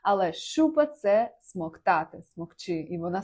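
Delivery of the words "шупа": шупа, the misheard form without the k; wrong-sounding шупа. Shown as a demonstration. Teacher demonstrating the misheard word, шупа with plain sh. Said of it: "почула це слово як шупа".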